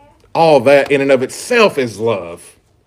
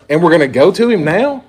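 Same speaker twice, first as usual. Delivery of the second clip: higher, rising, disbelieving question; incredulous question; 4 semitones higher, 3 dB louder than usual. Acted. Disciple questioning Jesus' decision to go, incredulous. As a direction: question the plan as if it's absurd. Repeats verse as baffled listener.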